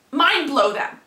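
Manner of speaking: ordinary talk, nothing marked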